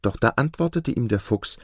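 ordinary delivery

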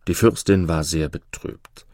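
usual speaking voice

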